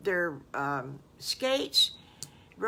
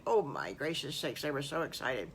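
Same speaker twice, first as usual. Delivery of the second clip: plain reading voice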